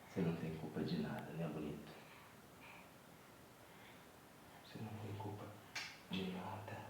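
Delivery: low, hushed and tender; soft reassurance. Real, not acted. Father reassuring his baby, soft and low.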